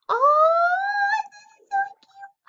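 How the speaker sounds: very high, squeaky cute-overload squeal; squealing delight. Real, not acted. Kid gamer squealing over a cute item.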